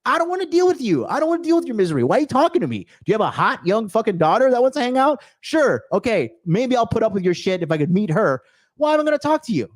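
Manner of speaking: high-pitched, indignant rant; exasperated rebuff. Acted. Speaker refusing to deal with a miserable person's misery, exasperated.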